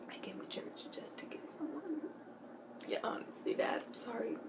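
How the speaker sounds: church whisper. Retold or imitated. Teen whispering to her dad during church service. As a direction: whisper it so church can't hear.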